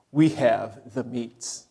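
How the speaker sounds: low, slow announcer impression; ad slogan voice. Pastor quoting a fast food commercial, low slow announcer voice. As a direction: drop low and draw out the slogan. Imitating a newer restaurant ad slogan.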